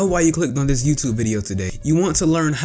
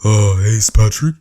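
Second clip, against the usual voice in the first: lower, louder dopey cartoon starfish; Patrick Star voice; 4 semitones lower, 7 dB louder than usual. Shown as a demonstration. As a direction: greet like goofy Patrick. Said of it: "the Patrick Star voice".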